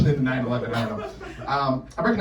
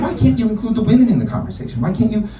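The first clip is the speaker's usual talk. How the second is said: mom voice; 9 semitones higher, 4 dB louder than usual. Retold or imitated. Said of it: "She was like".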